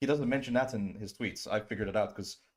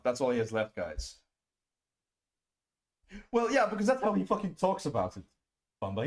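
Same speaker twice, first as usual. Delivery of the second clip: usual speaking voice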